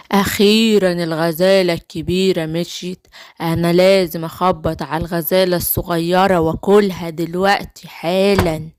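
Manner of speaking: low wolf voice plotting; low-voiced villain wolf; scheming wolf.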